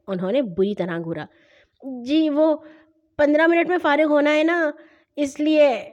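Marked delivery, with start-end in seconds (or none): 2.0-5.8 higher, halting excuse; hesitant stammer. A narrator's reading. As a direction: stall between phrases like a nervous child. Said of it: "میں ہکلایا". (ہچکچاتے ہوئے، اٹک اٹک کر)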